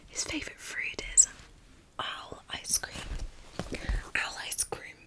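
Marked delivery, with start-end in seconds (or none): none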